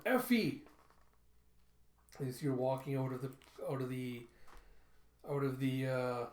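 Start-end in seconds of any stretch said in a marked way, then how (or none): 0.0-0.6 high, loud call; raised, high-pitched shout. Acted. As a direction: call her name across a distance. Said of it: "you hear him call out".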